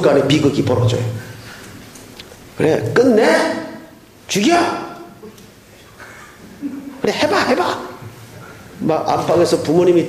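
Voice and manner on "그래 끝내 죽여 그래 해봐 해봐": mock fight. Young wife daring her husband in a fight, shrill and taunting. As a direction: dare your spouse, pitched way up.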